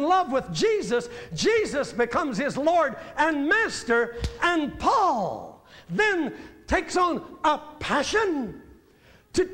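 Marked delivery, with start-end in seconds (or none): 4.4-9.5 a swelling sermon peak; preaching at full force. Acted. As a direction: lift the room, call it out.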